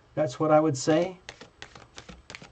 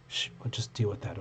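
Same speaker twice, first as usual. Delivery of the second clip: hushed reassurance; 5 semitones lower, 13 dB quieter than usual. Real, not acted. Hushing, reassuring Tina.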